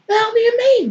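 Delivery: high, loud incredulous squawk; Baring confused by Undoom, high and loud; baffled Baring. Acted. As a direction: shoot up high in disbelief.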